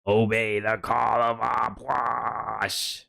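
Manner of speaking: slow, drawn-out ult call; Posh. Retold or imitated. Streamer quoting the god's ult, slow character impression. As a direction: intone it slowly, stretching the final word. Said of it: "that was his ult he just said".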